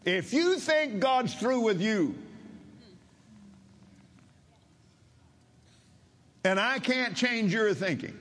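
high, forceful ultimatum; roaring preacher. Retold or imitated. Preacher warns the man seeking prayer, roaring. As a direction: press each word home, pitched high. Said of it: "he was not speaking softly".

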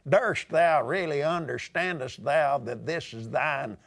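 lightly hammed-up thee-and-thou prayer in Texas twang; mock old English prayer in a Texas accent. Preacher mocking his own stilted prayers, Texas-accented. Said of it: "in a Texas accent".

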